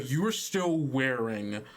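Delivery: ordinary delivery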